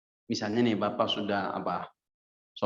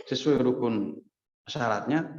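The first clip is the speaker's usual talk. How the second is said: normal talking voice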